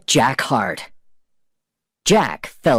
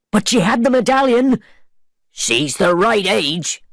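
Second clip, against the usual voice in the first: shouting; 9 semitones higher than usual. A narrator's reading. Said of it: "The pirates shouted again".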